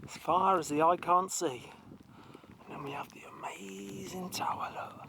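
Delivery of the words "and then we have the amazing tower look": whispering. Real, not acted. Hushed aside about tower.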